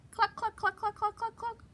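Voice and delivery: high clucking cluck cluck; high hen clucking. Shown as a demonstration. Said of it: "sometimes they say".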